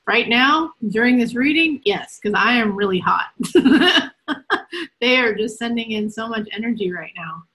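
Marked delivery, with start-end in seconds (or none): 3.4-5.0 loud, high laugh; real burst of laughter. Real, not acted. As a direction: laugh out at her own remark. Laughing at feeling hot.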